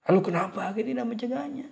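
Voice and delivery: high, fast, cross question; overdone angry tone. Shown as a demonstration. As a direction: demand it in an exaggerated angry tone. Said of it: "terlalu nada marah tuh". (nada terlalu marah)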